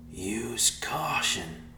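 slow, distant voice in the mind; mysterious voice. A narrator's reading. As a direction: speak slowly like a voice from afar. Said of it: "a voice said".

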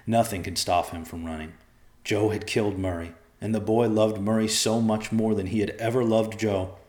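ordinary delivery

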